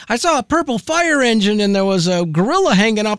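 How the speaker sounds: put-on six-year-old's story. Host mimicking a child making up stories, a light six-year-old voice. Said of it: "maybe a six-year-old story".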